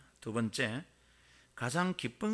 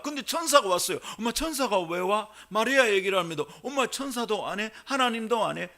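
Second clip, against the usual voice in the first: loud, high, excited sermon delivery; fired-up preaching; 7 semitones higher, 7 dB louder than usual. Acted. Preaching at full force.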